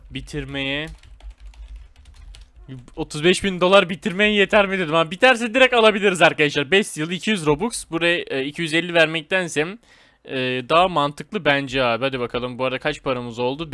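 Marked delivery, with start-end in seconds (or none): none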